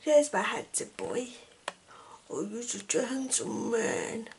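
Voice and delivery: gently adore the dog; soft pet talk. Real, not acted. Praising her dog Diesel, soft tender coo.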